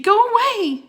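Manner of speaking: high, loud yell at the wolf; shout. Acted. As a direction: drive the wolf back with your voice. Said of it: "he shouted".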